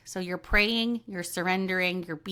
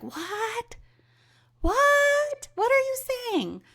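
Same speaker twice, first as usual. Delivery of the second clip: much higher, calling out over loud music; straining to hear; slower than usual. Pretend. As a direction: shout over a concert you can't hear. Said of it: "you're at a concert and it's so loud".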